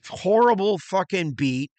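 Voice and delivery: ordinary talk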